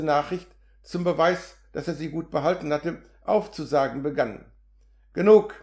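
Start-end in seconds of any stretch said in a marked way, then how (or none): 5.1-5.5 louder, impatient cut-off; shouting. A narrator's reading. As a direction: read it as a restrained shout. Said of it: "wegen K.s Schreien".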